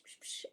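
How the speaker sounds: short breathy psh pushes of air; whispered psh bursts. Shown as a demonstration. Teacher shows the little push of breath support, whispered psh. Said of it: "give a little psh".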